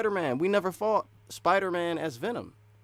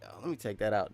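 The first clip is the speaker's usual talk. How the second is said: ordinary talk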